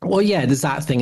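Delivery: normal talking voice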